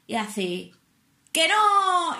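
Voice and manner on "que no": a sudden high playful shout; spring the good news loudly on her; teasing shout. Retold or imitated. Instructor Rubén teasing the nervous student, high playful shout.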